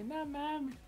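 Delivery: quiet defeated no mames; resigned sigh. Retold or imitated. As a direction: let it fall out in defeat. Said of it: "ya me bajé bien resignado".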